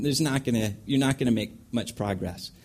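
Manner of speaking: ordinary delivery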